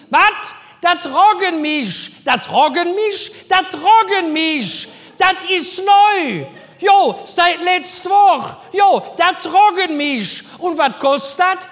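laut, hoch, langsam gerufen aus dem Hinterraum; Helga von hinten. Retold or imitated. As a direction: gedehnt und laut von weit hinten rufen. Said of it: "Helga von hinten". (ruft gedehnt und laut von hinten)

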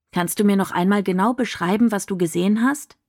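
ordinary delivery

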